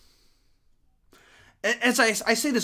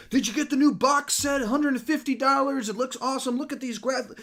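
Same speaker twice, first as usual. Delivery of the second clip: much higher, eager fan voice; mock excited fan; 13 semitones higher, 3 dB louder than usual. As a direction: ham up a gushing collector showing off. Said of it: "and people were like".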